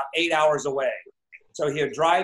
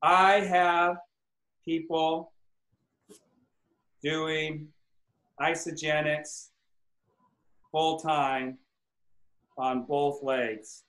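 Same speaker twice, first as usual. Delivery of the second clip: each word spaced by long pauses, fading softer; slow dictation; 2 semitones lower, 4 dB quieter, much slower than usual. Shown as a demonstration. Coach writes the affirmation word by word, slowly. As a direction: spell it out slowly as you write. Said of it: "All right, so".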